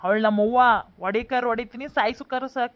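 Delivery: loud bullying retort; acted hostile defiance; angry threat. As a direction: warn her off forcefully. Son threatens interfering neighbour.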